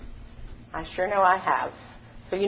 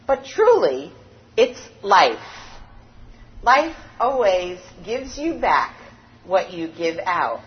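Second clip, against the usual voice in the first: normal talking voice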